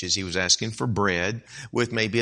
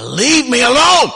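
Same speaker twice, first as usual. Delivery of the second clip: loud, much higher and slow; exasperated neighbor; 12 semitones higher, 10 dB louder than usual. Acted. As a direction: drag out "leave me alone" in exasperation. Neighbor loses patience.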